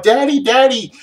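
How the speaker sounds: high, loud imitation of children calling; eager small child calling Daddy, hammed-up; excited kids. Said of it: "all excited".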